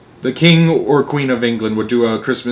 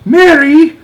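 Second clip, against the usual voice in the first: a high, loud, sharp call of the name; 11 dB louder than usual. Acted. Queen calls her dropping maid sharply, high and loud. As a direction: snap the maid to attention. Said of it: "the famous voice was saying rather sharply".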